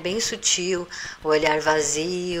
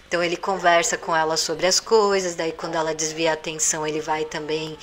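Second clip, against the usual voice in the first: ordinary talk, nothing marked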